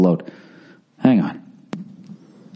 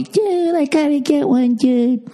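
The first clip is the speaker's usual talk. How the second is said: dude impression; slower than usual. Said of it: "Jim Norton and Bobby".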